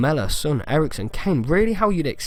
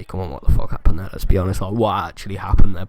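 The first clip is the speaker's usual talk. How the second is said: ordinary delivery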